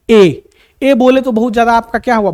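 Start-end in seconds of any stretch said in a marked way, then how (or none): 0.1-0.4 "ए" sounded out loud and open; demo vowel. Shown as a demonstration. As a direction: say "ए" loud for them to repeat. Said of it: "आप बोलो मेरे साथ". (मेरे साथ बोलो, ज़ोर से "ए")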